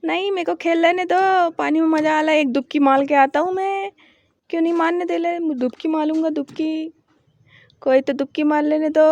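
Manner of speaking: put-on high, loud lisping baby talk; put-on child voice, loud whine of protest; pleading little kid. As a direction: beg like a toddler who wants to keep playing. Voices child begging to play in water.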